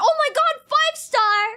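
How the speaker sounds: loud, high excited shout. Real, not acted. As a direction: scream with joy at the win. Big gacha win reaction.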